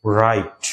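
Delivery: রাইট said as "rait", no w; teacher demo of a silent w. Shown as a demonstration. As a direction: model রাইট starting on the r sound. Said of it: "উচ্চারণ দেখো".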